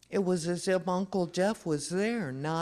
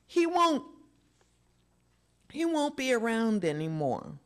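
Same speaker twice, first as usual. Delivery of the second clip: words choked out through grief; choking it out; 8 semitones higher than usual. A narrator's reading. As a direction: force the words past a lump. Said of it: "choking it out".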